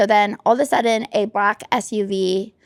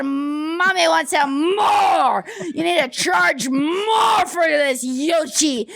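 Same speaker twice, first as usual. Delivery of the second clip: high, slow, shouted demand for more; hammed-up greedy Mommy; 7 semitones higher, much slower than usual. Gushing to the chef about his yogurt, slow high shouting. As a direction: belt it out like a diva starved for dessert. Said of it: "I said".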